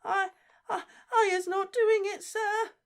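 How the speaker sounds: very high, tearful gasping elf voice; Winky pleading with Diggory; squeaky crying elf. Acted. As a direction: gasp out a tiny elf's plea. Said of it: "Winky gasped".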